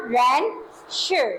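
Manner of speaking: रेंशर drawn out very slowly; teacher models रेंशर slowly for students; slow model reading. Shown as a demonstration. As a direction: spell out रेंशर slowly for the class. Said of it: "क्या पढ़ेंगे इसे हम?".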